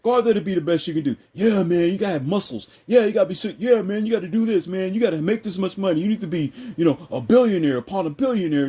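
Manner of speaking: high, driven hype-man voice; mock pep talk, piling on the pressure; hyped-up urging. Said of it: "but men are told".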